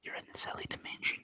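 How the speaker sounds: hushed spooky whisper. A narrator's reading. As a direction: whisper it like a mystery.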